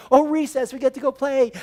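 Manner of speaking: 模仿孩子雀跃的语气; mock kid glee. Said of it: "You'd like".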